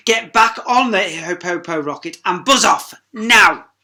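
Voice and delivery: high, loud shouting scorn; scornful scolding. Acted. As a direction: drive her off in contempt. Hercules scolds.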